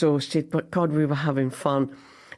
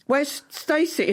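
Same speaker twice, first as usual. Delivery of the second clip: imitating a relative; 10 semitones higher, slower than usual. Family member asking Gavin.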